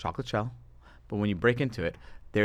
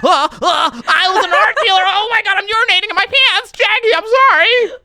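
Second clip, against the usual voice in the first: hammed-up, very high loud panicked yelp; panicked self-mockery; 11 dB louder than usual. Retelling a failed date with Jackie, mock panic. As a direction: squeal it out, loud and high, flustered beyond saving. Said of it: "Not me. I was like".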